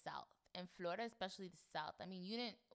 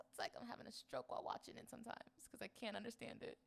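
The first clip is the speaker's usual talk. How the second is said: ordinary talk, nothing marked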